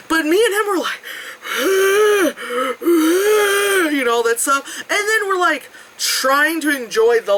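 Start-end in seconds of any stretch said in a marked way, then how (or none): 1.1-3.9 weary sigh. Real, not acted. Exhausted at the top.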